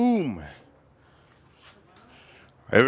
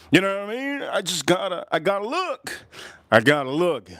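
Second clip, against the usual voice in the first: ordinary talk, nothing marked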